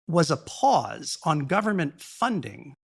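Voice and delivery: slow, with emphasis on "pause" and "government funding"; deliberate weight on "pause" and "government funding"; stress on pause, government funding. Real, not acted. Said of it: "Emphasis on pause and government funding".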